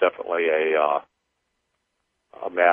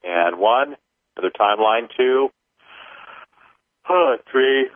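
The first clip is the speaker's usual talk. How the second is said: measured, higher counting voice; paced ritual counting; 5 semitones higher than usual. Acted. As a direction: count each number out deliberately. Leads a clearing count.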